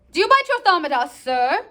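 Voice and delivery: high, loud challenge; challenging. Acted. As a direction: demand an answer, bristling. Reading a challenge.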